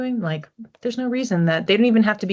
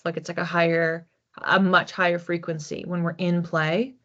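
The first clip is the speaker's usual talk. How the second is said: ordinary talk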